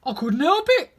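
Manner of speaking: loud, high sulky protest. Acted. Five sulks back at Seven, loud and high. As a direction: whine the excuse like a told-off worker. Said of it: "said Five in a sulky tone".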